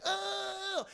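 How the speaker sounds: sharp high yelp; high mock cry of pain. Streamer voices Leia getting shot, high yelp. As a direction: yelp as if hit.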